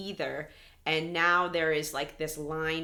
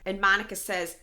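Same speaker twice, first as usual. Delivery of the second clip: ordinary talk, nothing marked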